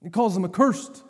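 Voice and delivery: ordinary delivery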